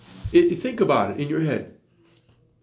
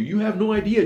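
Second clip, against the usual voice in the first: ordinary talk, nothing marked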